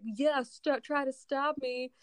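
cocky high dare; defiant. Acted. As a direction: taunt her to stop you. Stan defiantly refusing.

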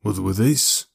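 throaty thug with a Scottish lilt; deep, throaty Scottish. A narrator's reading. Pinky explains his tattoo, throaty Scottish voice. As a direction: speak from the throat with a hint of Scottish. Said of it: "His voice was deep and throaty".

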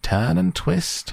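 high, slow honking call; honking goose. A narrator's reading. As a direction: honk it out like a goose. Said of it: "honked the goose".

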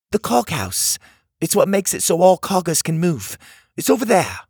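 put-on high, brisk little mechanical girl; a small bossy girl urging them on; bossy cog girl. A narrator's reading. Cog girl warning strangers about Tuga, high and urgent.

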